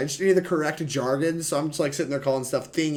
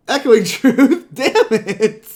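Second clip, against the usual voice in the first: slow words through a laugh; 10 semitones higher, 12 dB louder, much slower than usual. Real, not acted. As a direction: laugh out the card name in disbelief. Laughing at opponent's answer.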